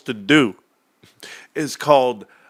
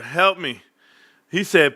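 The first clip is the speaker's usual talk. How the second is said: ordinary talk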